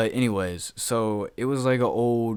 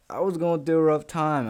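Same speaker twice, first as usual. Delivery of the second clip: high, louder mock-defensive plea; pleading his case, pitched up; defensive excuse; 8 semitones higher, 5 dB louder than usual. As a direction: protest in a raised pitch, making excuses. Defensive plea about his phone tabs.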